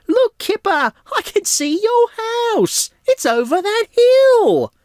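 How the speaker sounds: very high cartoon voice, calling across; Tiger calling out. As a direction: call down from the windmill, high and drawn out. Tiger stuck on windmill calling.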